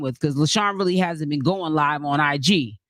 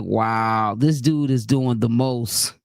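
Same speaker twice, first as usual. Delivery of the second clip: low-voiced mock disbelief; 8 semitones lower, slower than usual. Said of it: "I was like".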